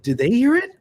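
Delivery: can't believe the tourists heard nothing; incredulous. Retold or imitated. Baffled others heard nothing.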